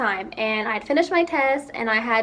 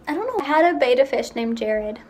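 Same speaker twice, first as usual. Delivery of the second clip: ordinary talk, nothing marked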